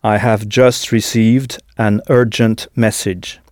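slow, careful, every word clear; slow model sentence. Shown as a demonstration. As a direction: say it slowly for learners to copy. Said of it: "répéter la phrase en entier".

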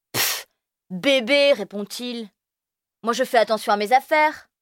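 mock the little one; sneering. Firmin taunting Elio about the flute.

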